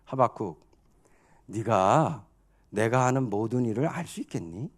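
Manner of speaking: low, soft divine questioning; acted God gently challenging a servant; God's voice. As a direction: question quietly, pitched well down. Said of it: "주시는 말씀은 한마디로 말하면 이겁니다".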